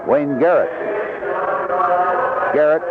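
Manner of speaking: ordinary delivery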